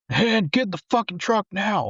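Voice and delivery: high, urgent mock shout; retold yell. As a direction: yell him back into the truck. Crew yelling to pull him in.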